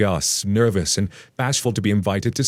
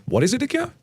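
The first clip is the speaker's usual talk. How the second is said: feigned confusion, pitched way up; mock puzzled. Narrator playing dumb to the girlfriend's mother, mock puzzled. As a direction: pretend not to catch the word. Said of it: "And I was just saying".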